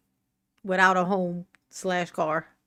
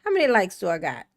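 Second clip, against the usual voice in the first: usual speaking voice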